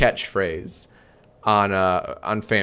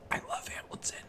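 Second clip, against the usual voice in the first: whisper; 17 dB quieter than usual. Acted. Whispered aside.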